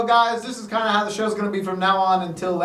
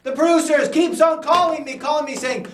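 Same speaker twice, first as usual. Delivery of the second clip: exasperated; 9 semitones higher, 4 dB louder than usual. Acted. Complains about interrupting phone calls.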